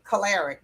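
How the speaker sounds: choleric said "kuh-LAIR-ik"; mispronounced choleric. Retold or imitated. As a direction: imitate the common slip, choleric as "kuh-LAIR-ik". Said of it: "even though they hear me say it".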